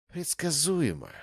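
bored contempt for a rival; dismissive. Acted. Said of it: "пренебрежительно прокомментировала".

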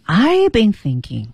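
I've been thinking 从高到低地说; high-to-low tune. Shown as a demonstration. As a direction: start I've high and let the line fall away. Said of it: "他是从高到低". (从高到低的语调)

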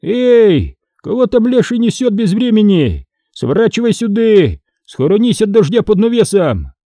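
high-pitched calling through the rain; shouting out. Acted. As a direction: hail travellers from under the shelter. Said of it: "закричал". (окликает громко, высоким голосом)

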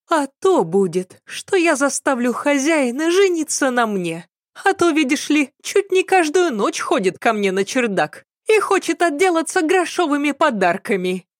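high-pitched maid character voice; scheming indignant maid. A narrator's reading. Maid announcing she will marry the master, high indignant voice. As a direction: complain high and self-assertive.

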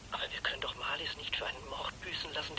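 wife protesting, horrified whisper. Acted. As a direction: whisper it in horror.

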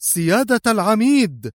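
high-pitched cry of alarm; urgent call. A narrator's reading. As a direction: call out urgently to your commanding officer. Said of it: "فهتف مدحت". (نداء عاجل)

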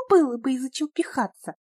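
put-on high, taunting girl's voice; mocking girl. A narrator's reading. Люба mocks crying Петя, high taunting voice. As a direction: tease the crybaby.

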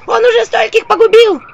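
high, loud squeaky yell; shouting imps. A narrator's reading. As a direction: warn her like frantic imps. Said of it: "кричали мне черти". (крик чертят)

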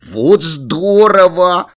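high, loud, stretched-out delight; gleeful childish exclamation; thrilled. A narrator's reading. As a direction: draw it out like a delighted kid. Children thrilled about presents.

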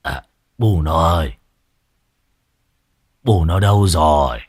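barely getting the words out; faint rasp. A narrator's reading. Old man, aching in bed, calls weakly for his child. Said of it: "Lão mới thều thào".

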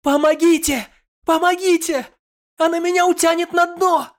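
high, desperate screaming for help; wild cry for help. Acted. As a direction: scream in terror, voice pitched high. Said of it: "сменился диким криком". (дикий крик о помощи)

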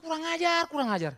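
high, loud rebuke falling away; exasperated outburst; indignant scolding. Acted. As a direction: scold in outrage. His indignant answer to the testimony.

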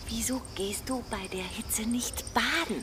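tonlos geflüstert; Flüstern. Acted. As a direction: leise zur Seite flüstern. Nachbarin flüstert dem Kind zu. (Nachbarin flüstert Emily zu, ganz tonlos)

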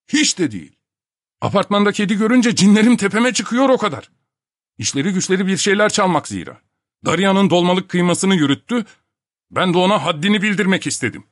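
high, loud indignant protest; heated self-defence. A narrator's reading. Topak excusing his attack on the cat, heated and indignant. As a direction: justify yourself hotly.

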